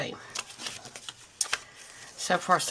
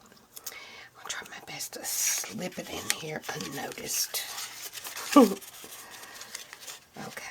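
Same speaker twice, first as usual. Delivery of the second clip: ASMR whisper. Acted. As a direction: whisper it gently to the viewer. Crafting, whispered ASMR style.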